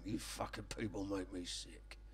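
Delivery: low, hushed muttering to himself. Retold or imitated. Ron muttering in the garden, low and hushed. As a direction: grumble it under your breath. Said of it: "he'd be muttering to himself".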